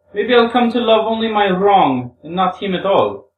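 high woman's character voice, musing aloud; Grushenka talking to herself. Acted. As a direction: think out loud in a high, strong voice. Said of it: "talking to herself".

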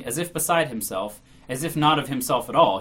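ordinary delivery

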